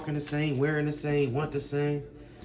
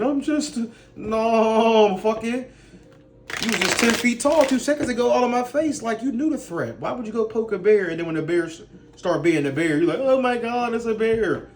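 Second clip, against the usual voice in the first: higher and louder, hammed-up woman backing off; imitating a woman who picked a fight, higher and louder; mock girl voice; 6 semitones higher, 3 dB louder than usual. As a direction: play her suddenly scared of the bear she poked.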